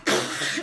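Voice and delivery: loud burst of stifled laughter; explosive re-enacted snort; sputtering laugh. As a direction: imitate cracking up helplessly. Re-enacts helpless laughing with bartender.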